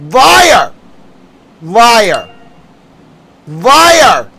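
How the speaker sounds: loud shouted accusation; angry yell. Real, not acted. Streamer denouncing the president: loud angry yell. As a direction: bark each word out in fury.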